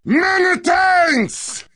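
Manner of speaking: high, slow, drawn-out shout of thanks; mock announcer. Co-host hamming up the caller's thanks, drawn-out high shout. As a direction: belt it out like a game show host.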